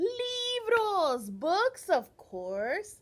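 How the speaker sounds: high, loud delighted reveal; excited reveal. Real, not acted. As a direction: announce it with delight.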